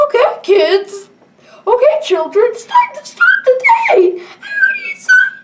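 very high, loud sing-song teacher voice; teacher calling. Acted. Teacher calling the kids inside, squeaky and loud. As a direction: call the children in like a chirpy teacher.

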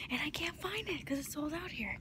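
whispered complaint. Real, not acted. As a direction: breathe it out under your voice. Whispering in the store.

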